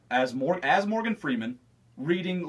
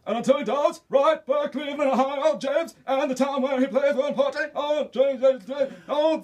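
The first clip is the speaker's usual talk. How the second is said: much higher, louder rapid rap-style voice; floundering hammed-up female rapper; 9 semitones higher, 5 dB louder than usual. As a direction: flail into a fast, loud high rap.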